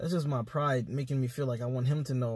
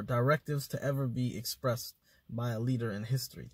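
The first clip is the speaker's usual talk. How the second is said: usual speaking voice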